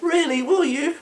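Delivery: very high, squeaky disbelief; mock amazed inmates. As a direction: squeal it like giddy kids.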